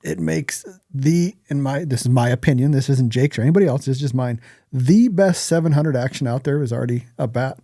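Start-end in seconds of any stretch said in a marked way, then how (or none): none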